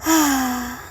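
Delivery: long, deflated exhale; disappointed sigh. Acted. After checking the phone for new messages, a disappointed sigh.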